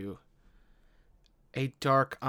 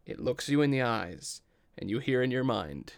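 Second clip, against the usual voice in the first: normal talking voice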